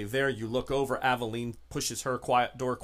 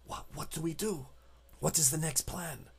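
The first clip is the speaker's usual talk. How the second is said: soft, hushed character voice; guarded, lowered volume; secretive contact; 3 semitones higher, 6 dB quieter than usual. Acted. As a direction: ask quietly, keeping it secret. Contact asks quietly for plan.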